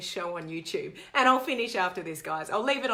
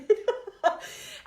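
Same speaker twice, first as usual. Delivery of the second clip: high laugh; 11 semitones higher than usual. Real, not acted. Laughs at the prank ending.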